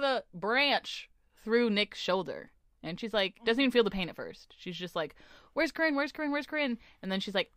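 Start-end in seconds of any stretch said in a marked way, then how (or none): 5.5-6.8 panicked repeating. Retold or imitated. Injured character panicking after crash.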